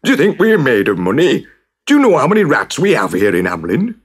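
mayor blustering about the cost; outraged mayor. Acted. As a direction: bluster at the stranger.

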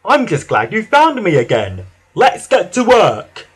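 glad, eager little engine; rally your friend, rising high and loud at the end; Whiff. A narrator's reading. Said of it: "whistled Whiff".

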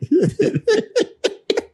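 short high burst of laughter; amused laugh. Real, not acted. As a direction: break into a quick high laugh. Host laughing at the story.

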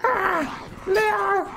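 very high, repeated wailing cry; panicked calling. Pretend. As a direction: squeal the name like a game emergency. Repeatedly screams for companion. (chillido agudo de auxilio)

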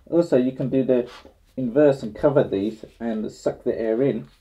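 muffled behind face mask; speaking inside sealed respirator; through a respirator mask. Real, not acted. Seen 3: Grey half-face respirator worn over mouth and nose throughout.

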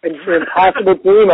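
ordinary talk, nothing marked

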